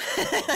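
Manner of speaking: high greedy laugh; greedy triumphant laugh; gleeful laugh. Acted. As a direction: laugh at the thought of gold. Kid gloats about gold.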